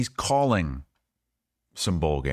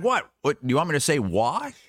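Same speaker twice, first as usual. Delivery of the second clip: higher-pitched, incredulous rising question; mock disbelief, climbing high at the end; imitating baffled grandpa; 8 semitones higher than usual. Grandpa with no answer to his niece, mimicked high and incredulous. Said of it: "He's like".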